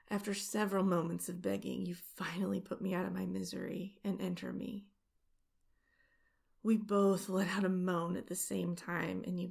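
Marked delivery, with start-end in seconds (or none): none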